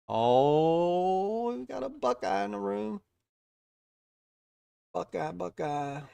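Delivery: high, loud, sing-song teasing call; playful excitement. Real, not acted. Streamer teasing an Ohio viewer who joined chat, playful sing-song. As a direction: tease the Buckeye with a drawn-out lilt, slowing on the repeats.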